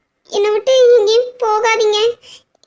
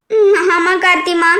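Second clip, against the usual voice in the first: ordinary delivery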